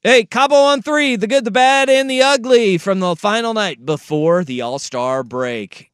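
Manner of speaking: booming, slow radio host intro; radio announcer. Pretend. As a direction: announce it big like a show opener. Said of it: "let's do radio".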